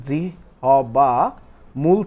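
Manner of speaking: জিহ্বা drawn out syllable by syllable, pause before মূল; slow syllable breakdown. Shown as a demonstration. Teacher breaking down a term for the class, slow and segmented. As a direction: stretch জিহ্বা into separate beats, then মূল.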